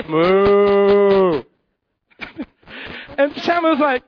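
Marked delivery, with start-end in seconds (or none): 0.0-1.4 loud low mooing; cow moo. Acted. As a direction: moo like a cow giving him away. Cow noise interrupting.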